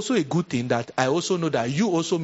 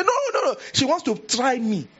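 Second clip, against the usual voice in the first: much higher, offended shriek; indignant old sister; 8 semitones higher than usual. Retold or imitated. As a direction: refuse like a proud elder. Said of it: "They say".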